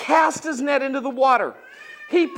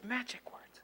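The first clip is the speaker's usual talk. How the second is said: whisper; 22 dB quieter than usual. Acted. Whispering magic words.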